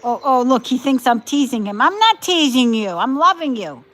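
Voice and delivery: loud doting coo. Real, not acted. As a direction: gush at the dog. Cooing loudly at dog.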